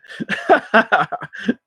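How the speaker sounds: real high laugh; amused laugh. Real, not acted. Laughs at chat comment.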